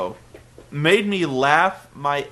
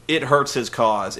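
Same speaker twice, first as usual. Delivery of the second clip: ordinary delivery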